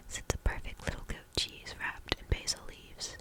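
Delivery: usual speaking voice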